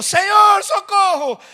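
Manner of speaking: loud, high-pitched retold shout; full-voiced preacher's shout for rescue; shouted cry for help. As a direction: cry out like a drowning man. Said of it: "imediatamente ele gritou".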